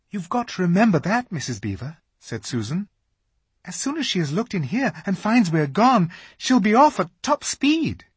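put-on high girl's voice; worried Susan; anxious Susan. A narrator's reading. Susan urges haste.

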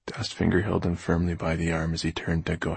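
usual speaking voice

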